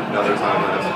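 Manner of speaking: ordinary delivery, nothing marked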